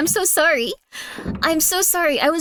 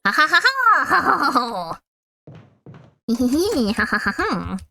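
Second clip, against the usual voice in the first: drawn-out theatrical ha ha ha; hammy evil laugh, slow; slow villain laugh; much slower than usual. Acted.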